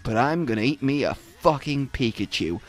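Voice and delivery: low, soft and dazed, lost in its own head; a confused beast voiced low and soft; dazed monster's thoughts. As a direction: mumble it like a creature with no idea where it is. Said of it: "that is the expression of".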